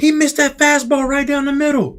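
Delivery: high, scoffing complaint; whiny fan. Retold or imitated. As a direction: whine like a know-it-all fan.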